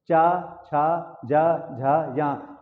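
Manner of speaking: each syllable drawn out slowly; slow teacher read of the palatal letters; slow letter demo. Shown as a demonstration. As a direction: sound out चा, छा, जा, झा, या slowly for the class. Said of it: "बोल के देखते हैं इन वर्णों को".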